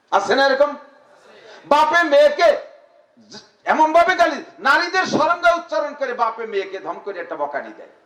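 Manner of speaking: rousing high preacher voice; fiery preaching. Acted. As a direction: preach it out to the crowd. Preaching at full force.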